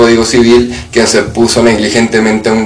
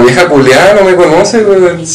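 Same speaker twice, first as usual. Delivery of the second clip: higher-pitched retold indignation; indignant retort; 7 semitones higher than usual. Speaker retelling their reaction to the old woman, indignant. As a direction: protest in a raised pitch, mock outraged. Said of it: "Y yo como".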